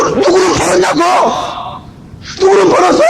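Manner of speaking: high, mocking yell of rebuke; mocking shout. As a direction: fling the question at them. Preacher mocks, shouting rebuke. (누가 벌었냐고 비웃으며 외치기)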